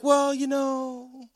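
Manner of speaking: imitating a wife's leisurely, meandering telling; rambling wife. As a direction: stretch the words out, wandering along. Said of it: "She's like".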